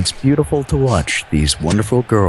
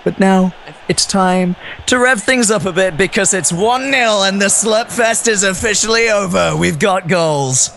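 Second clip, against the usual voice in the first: hyped-up, higher, slowing into the goal news; dramatic upswing; 8 semitones higher than usual. Acted. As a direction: rev up the commentary. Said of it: "that dramatic upswing that you provided".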